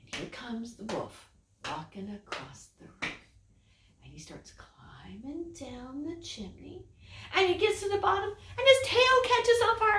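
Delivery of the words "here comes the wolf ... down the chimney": spooky whisper. A narrator's reading. Storyteller building suspense for the children, whispered.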